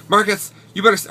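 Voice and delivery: high, loud, flamboyant scolding. Retold or imitated. Boyfriend scolding Marcus in the rain, high, loud, flamboyant. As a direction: camp it up and scold your boyfriend. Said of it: "I apologize for the stereotypical voice".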